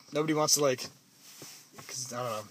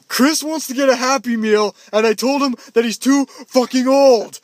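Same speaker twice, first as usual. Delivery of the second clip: higher, louder mock teen complaint; angry teen; 6 semitones higher, 7 dB louder than usual. Teen explaining the fight, higher and louder. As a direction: complain like a peeved teenager. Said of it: "They're like".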